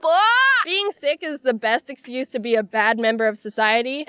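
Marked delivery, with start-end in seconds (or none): 0.0-0.6 sudden shriek. Acted. Mock outburst ends acted exchange.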